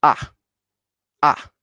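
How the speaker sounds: short falling "ah"; tone four. Shown as a demonstration. Teacher reviews tone four for learners, short falling "ah". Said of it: "tone four will be".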